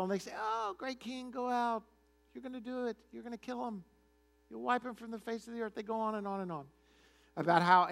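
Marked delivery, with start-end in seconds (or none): 0.4-5.7 higher, softer gushing mock praise; mock flattery. Retold prophets flatter the king before battle, mock fawning. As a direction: lightly imitate fawning yes-men, pitched up.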